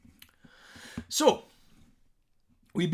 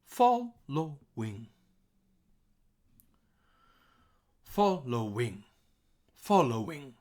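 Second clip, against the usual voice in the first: Following in three beats, FOL high then dropping; syllable-by-syllable stress; slower than usual. Shown as a demonstration. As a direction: spread out Following, pitching up the first syllable. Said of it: "we have high, low, high".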